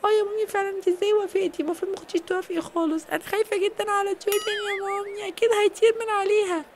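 a scared little sister begging mom; worried child voice. Acted. Little girl begging mom to take Julian off.

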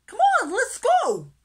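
very high, loud whoop; rally the gang at the top of your voice; eager rallying cry. A narrator's reading. Little fish urging friends on, high excited shout. Stanley urges his friends on.

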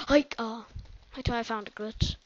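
ordinary talk

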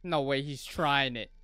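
ordinary talk

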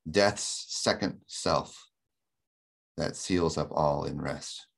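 slow, weighted "Death's second self", softening into "rest"; strong stresses. Shown as a demonstration. Lecturer reading the favorite line of the poem, slow and stressed. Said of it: "that continues the next line".

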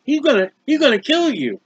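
ordinary talk

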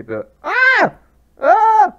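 loud, very high cries of surprise; real pained startled shrieks; startled yelps. Real, not acted. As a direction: yelp as a bird pecks your foot. One of the turkeys is pecking my foot.

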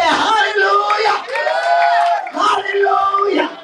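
high, loud hallelujahs; shouted praise. Acted. Preacher acting a believer jumping up, shouting praise. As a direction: shout it out like you just jumped up.

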